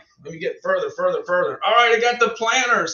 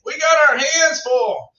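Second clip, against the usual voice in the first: loud; 6 semitones higher, 7 dB louder, slower than usual. Real, not acted. Emphatic about busy household.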